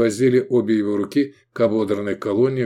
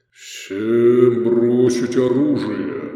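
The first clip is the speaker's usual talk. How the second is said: very slow, booming order; thundering command; 3 dB louder, much slower than usual. A narrator's reading. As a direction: boom it out, stretching every word. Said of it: "загромыхал вдруг незнакомый голос".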